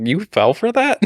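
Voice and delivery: shocked you fell for it; incredulous. Retold or imitated. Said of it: "I was like".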